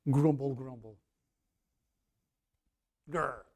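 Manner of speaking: low, soft grumble; grumbling. Acted. Preacher imitating someone muttering complaints, grumbling low. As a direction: grumble it under your breath with a growl. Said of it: "Someone's muttering".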